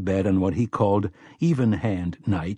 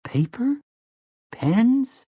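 very high, rising wonder; amazed questioning; 13 semitones higher, much slower than usual. Acted. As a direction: ask each item in growing disbelief. Boy amazed by a writing desk.

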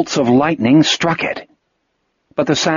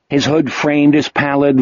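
ordinary delivery, nothing marked

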